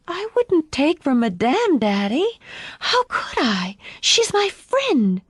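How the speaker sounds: put-on high, pleading child's voice; hurt little girl. A narrator's reading. Daughter protesting to her father, hurt and pleading. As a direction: protest innocence, stretching the last words. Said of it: "her mouth quivered open".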